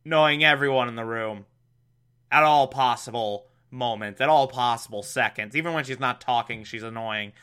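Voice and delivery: normal talking voice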